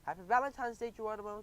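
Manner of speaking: spring the surprise; mild cheer. A narrator's reading. Friends surprise Geronimo, a lightly voiced happy shout. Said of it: "they shouted".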